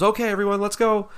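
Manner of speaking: high-pitched imitated call to a group; ride attendant. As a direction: mimic an attendant herding guests along. Ride worker calls the group along.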